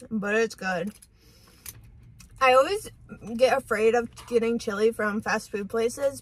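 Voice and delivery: eating between words; talking while chewing. Real, not acted. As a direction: mouth full of food. Seen 2: bites fries at -5, chews with full cheeks throughout.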